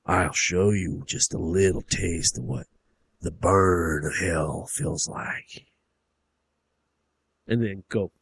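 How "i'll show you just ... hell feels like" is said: low, very slow drawl; stretched-out sinister father voice; menacing hillbilly dad. Acted. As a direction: promise torment slowly and deeply. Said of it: "He's like".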